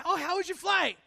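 put-on chirpy small-talk question; mimicked small talk. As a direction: ask it high, as mock small talk.

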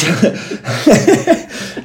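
high, breathy burst of laughter; giggling. Real, not acted. Guest cannot get the explanation out, giggling. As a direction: dissolve into giggles while trying to explain.

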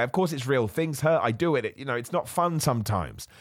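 normal talking voice

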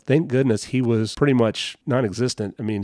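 ordinary talk

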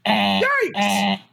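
high squeaky yelp; talking animal. Acted. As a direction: squeak out a startled yikes. Voicing cartoon chipmunk.

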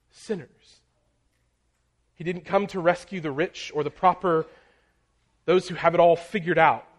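a building sermon climax; fervent preaching. Acted. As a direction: drive the point home from the pulpit. Preaching at full force.

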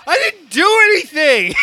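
loud, high-pitched frantic protest; panicked. Retold or imitated. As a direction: act out a friend starting to freak out. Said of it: "that panic moment".

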